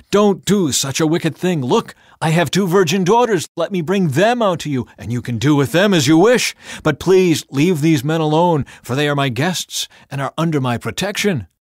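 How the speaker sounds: loud, high pleading; desperate begging. A narrator's reading. Lot begging the mob to spare his guests, loud and desperate. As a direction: cry out to stop them, pleading hard. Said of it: "he begged".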